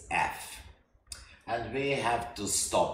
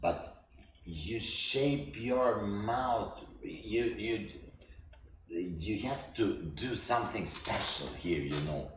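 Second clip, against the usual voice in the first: normal talking voice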